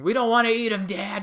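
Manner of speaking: put-on high, loud whiny child; complaining kid. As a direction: protest to dad like a disgusted kid. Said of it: "his kids are over there complaining".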